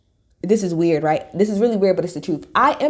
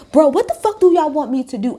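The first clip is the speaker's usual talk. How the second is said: higher, louder frustrated blurt; exasperated outburst; 7 semitones higher, 4 dB louder than usual. Retold or imitated. Host venting at critics, a mock exasperated higher, louder outburst. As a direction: throw up your hands in disbelief. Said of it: "It's like".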